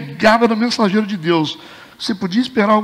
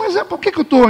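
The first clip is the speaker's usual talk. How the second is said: mock ironic surprise; 9 semitones higher than usual. A ironia dele.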